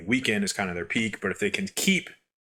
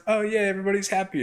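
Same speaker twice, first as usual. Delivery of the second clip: sarcastic cheer; 11 semitones higher, faster than usual. Retold or imitated. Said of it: "And it's like".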